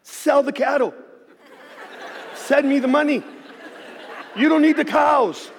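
cheeky demand to God with a laugh; laughing prayer. Retold or imitated. Pastor joking to God about selling cattle, laughing and high. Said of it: "So I told my father".

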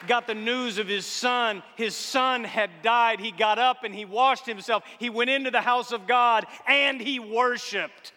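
loud, ringing sermon proclamation; full-force preaching. Acted.

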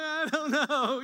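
crying man answering the nice churchgoers; embarrassed reply. Retold or imitated. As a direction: squeak it out, caught crying. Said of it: "I'm like".

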